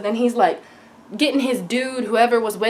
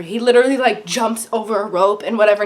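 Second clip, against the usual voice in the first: normal talking voice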